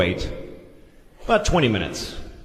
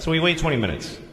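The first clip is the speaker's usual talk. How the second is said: normal talking voice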